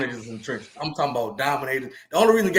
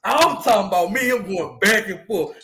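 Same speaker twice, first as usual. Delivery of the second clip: ordinary talk, nothing marked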